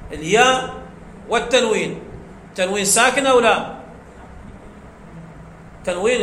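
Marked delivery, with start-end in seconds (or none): none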